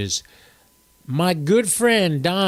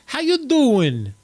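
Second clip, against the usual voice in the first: drawn-out New Jersey greeting, high and falling; put-on Jersey drawl, pitched up; New Jersey accent; 9 semitones higher, 3 dB louder, slower than usual. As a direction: ham up a slow, loud New Jersey accent. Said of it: "That's my New Jersey accent there".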